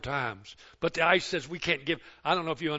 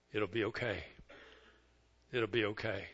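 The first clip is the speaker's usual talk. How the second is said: gentle reassurance; 7 semitones lower, 10 dB quieter than usual. Retold or imitated. God comforts the grieving grandfather, hushed and tender. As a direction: soothe like a hand on the shoulder. God's comfort voiced softly.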